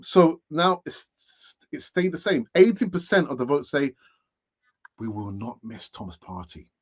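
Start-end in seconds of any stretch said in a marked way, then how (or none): 5.0-6.7 hushed, low and soft; near whisper. Real, not acted. Host reading poll results to viewers, near whisper. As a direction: drop it right down, almost whispering. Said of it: "I'm not sure why I'm whispering".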